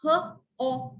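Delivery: "H-O-P" as three separate sounds, huh, o, puh; sounded out. Shown as a demonstration. Teacher spelling a word by sounds, sounded out. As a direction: say each sound of "H-O-P" slowly, with gaps. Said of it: "I would spell it as".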